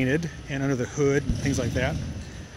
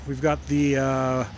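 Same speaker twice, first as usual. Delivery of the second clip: ordinary talk, nothing marked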